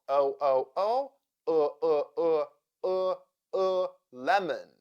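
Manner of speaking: O repeated, then UH repeated, into lemon; schwa drill. Shown as a demonstration. Teacher drilling the unstressed second syllable of lemon, schwa drill. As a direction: drill O turning into a neutral UH before lemon. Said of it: "O sounds like uh".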